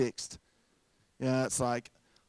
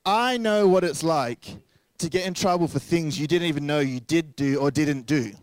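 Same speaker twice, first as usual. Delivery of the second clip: normal talking voice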